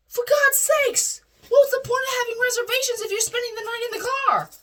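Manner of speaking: very high, loud, rushing mom scold; exasperated mom. Retold or imitated. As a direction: scold in exasperation, racing through.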